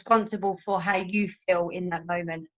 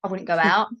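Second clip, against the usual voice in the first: ordinary delivery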